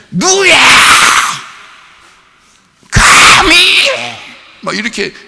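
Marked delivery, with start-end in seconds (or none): 0.1-3.9 much higher, loud indignant shout; mock angry outburst. Shown as a demonstration. Preacher mocking a harsh Jesus, high indignant shout. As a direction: snap at the crowd like an offended lord. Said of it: "이런 말투인 것 같아요?".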